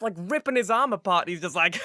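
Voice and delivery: usual speaking voice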